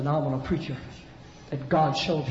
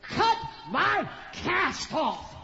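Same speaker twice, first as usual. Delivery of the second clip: higher, slow determined plea; insistent demand; 11 semitones higher, slower than usual. Retold or imitated. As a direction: press the demand hard. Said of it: "She said".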